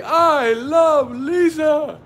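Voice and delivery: very high, slow, drawn-out crooning; lovesick movie quote. Retold or imitated. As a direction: stretch it out like a lovestruck fool. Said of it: "when he was like".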